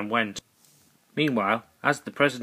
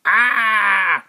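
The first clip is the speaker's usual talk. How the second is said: much higher, louder dying cry; death scream; 9 semitones higher, 7 dB louder than usual. Acted. Duck struck by the thrown lightsaber, death scream. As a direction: scream as the blade strikes.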